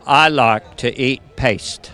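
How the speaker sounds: low, very slow Cockney impression; Michael Caine. Michael Caine in kindergarten, low and slow. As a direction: drawl it out like a tiny film star. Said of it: "Michael Caine in kindergarten".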